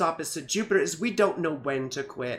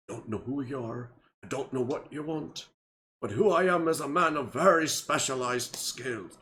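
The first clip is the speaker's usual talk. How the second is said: low, soft, badly faked Irish accent; a deliberately clumsy Irish movie-threat; 5 semitones lower, 3 dB quieter than usual. Doing Liam Neeson's Taken speech in a bad Irish accent. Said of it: "I have a bad Irish accent".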